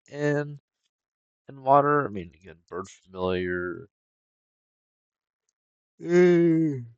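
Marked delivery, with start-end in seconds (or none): 6.0-6.9 yawning. Real, not acted. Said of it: "I don't know why I'm yawning".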